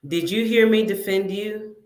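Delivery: ordinary delivery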